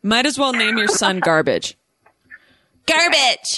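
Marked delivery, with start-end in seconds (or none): 2.9-3.6 put-on Valley Girl voice. Shown as a demonstration. Valley voice on garbage.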